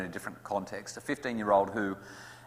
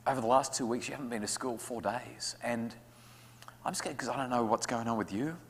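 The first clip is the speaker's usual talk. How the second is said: softer, tender confession; honest, scared parent; 5 dB quieter than usual. Acted. Parent admitting fear to school-refusing child, tender. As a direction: open up gently about your fear. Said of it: "the emotional wherewithal to say".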